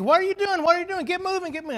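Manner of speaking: high, loud mock angry yelling; cursing the donkey. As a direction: shout at a stubborn donkey. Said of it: "started cursing and swearing at this donkey".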